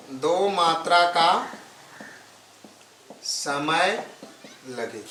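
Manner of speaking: slow, spaced phrases with long pauses, like dictating to a class; slow dictation. Shown as a demonstration. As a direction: let students write each word. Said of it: "slow dictation of definition". (धीमी गति से लिखवाना)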